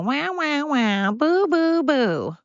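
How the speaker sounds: high, mocking "wah wah, boo boo" whimper; mock them like whining babies; mock crybaby wail. Mocking crybaby opponents with a high wah-wah whine. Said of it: "they're gonna".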